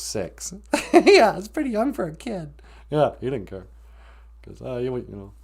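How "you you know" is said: ordinary talk, nothing marked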